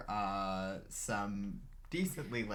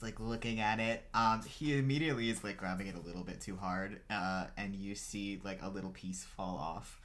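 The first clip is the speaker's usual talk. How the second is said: normal talking voice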